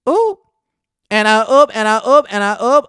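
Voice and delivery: high, loud gasp of delight at the shade; loud, high mock-shocked "and I oop"; scandalised squeal. Real, not acted. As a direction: shriek it like catching the tea live. Reacting to Kenya's rude question.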